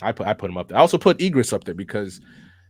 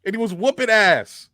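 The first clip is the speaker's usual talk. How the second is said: higher, louder, stretched out; excited; 7 semitones higher, 7 dB louder than usual. Real, not acted. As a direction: hype it up, slow and loud. Hyping up a character's fight.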